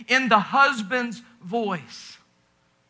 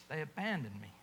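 soft, low, heartbroken; hushed and wounded; hurt husband; 8 semitones lower, 16 dB quieter, faster than usual. Acted. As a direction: say it like the words barely come out. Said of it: "Hear the hurt husband ... say these words".

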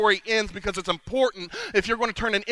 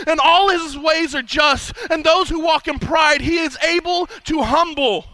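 loud, slow, proclaiming scripture read; full-force preaching; 6 semitones higher, 6 dB louder than usual. Acted. Preacher reading the king's praise to the congregation, loud proclamation. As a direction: proclaim it like the king's own decree.

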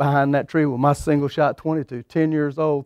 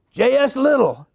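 a much higher, proud name drop; proud high answer; 9 semitones higher than usual. Retold or imitated. Boy telling the hunter who his grandfather is, proudly high. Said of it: "I said".

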